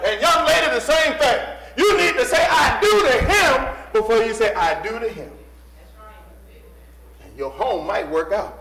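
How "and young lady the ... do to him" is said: loud, high pulpit exhortation; full-force preaching. Real, not acted. Preacher charging a young lady to love God first, full force. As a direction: drive it home to the congregation loudly.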